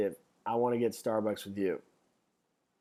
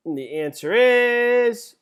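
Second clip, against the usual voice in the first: dramatic reveal; 9 semitones higher, 8 dB louder, slower than usual. Acted.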